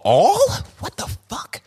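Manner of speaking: stunned disbelief, high questioning pitch on single words; loud high "all", hushed curse, high "three"; incredulous. Acted. Acts out disbelief at the fact.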